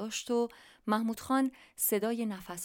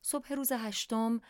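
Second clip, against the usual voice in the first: usual speaking voice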